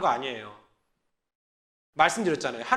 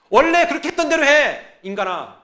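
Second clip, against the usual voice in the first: higher, louder exasperated command; angry God; 5 semitones higher, 8 dB louder than usual. Acted. God scolding stubborn people, higher and louder. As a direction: throw it at them in God's anger.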